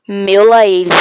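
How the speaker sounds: full-volume yell; shout on request. Shown as a demonstration. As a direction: shout it out for the viewers. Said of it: "Grita, meu lá ele".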